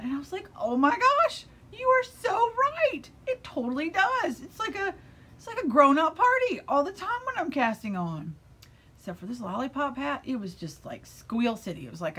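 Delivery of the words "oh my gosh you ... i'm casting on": high, loud gush of delight; excited agreement. Retold or imitated. Knitter agreeing with a funny podcaster, excited squeal. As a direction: squeal your total agreement.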